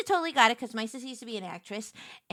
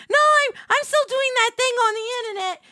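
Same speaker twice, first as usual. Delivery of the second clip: higher and louder, eager; excited insisting; 11 semitones higher, 7 dB louder than usual. Retold or imitated. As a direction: cheerfully insist. Insisting to family again.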